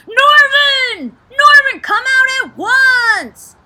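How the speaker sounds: loud high shout calling out to sea; crying out. A narrator's reading. Friends on the beach yell for Norvin to come out. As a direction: bellow his name across the water. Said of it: "his friends all cried".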